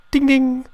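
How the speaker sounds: sung-out high, loud bell ding; playful high ding-ding. Pretend. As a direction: ring it out like a boxing bell. Mock bell to start commentary.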